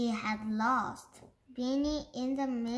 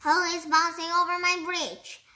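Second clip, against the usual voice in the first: loud, high, fast bellow; bellowing troll; 6 semitones higher, 9 dB louder, faster than usual. Acted. Troll demanding who crosses his bridge, loud bellow. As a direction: boom it out at the intruder. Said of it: "bellowed the troll".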